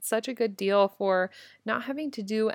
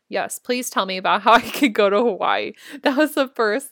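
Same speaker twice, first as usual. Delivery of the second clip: laughing; 8 dB louder than usual. Retold or imitated. Eager reply to coach retold.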